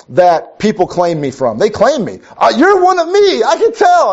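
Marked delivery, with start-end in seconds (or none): 2.4-4.1 squeaky high mock voice of someone claiming him; playful imitation in a much higher voice; high mock claimer. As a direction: pitch way up to mimic an eager stranger.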